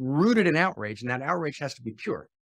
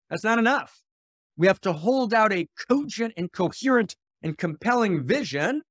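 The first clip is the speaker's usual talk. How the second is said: raised, louder preaching voice; preaching at full force; 6 semitones higher, 4 dB louder than usual. Acted. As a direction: preach it like a prophet's call. Prophetic call to be prophets.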